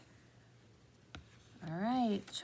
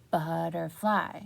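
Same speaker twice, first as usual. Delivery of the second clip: slow, stretched, louder; stretched-out word; 2 semitones lower, 4 dB louder, slower than usual. Shown as a demonstration. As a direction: stretch it like a rubber band. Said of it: "stretch out that word like a rubber band".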